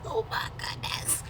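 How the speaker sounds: laughing till breathless; high squealing laugh. Real, not acted. Cracking up at the letter.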